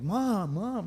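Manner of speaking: high, swooping mock plea to mom; retold whiny appeal to an angry mother; pleading son.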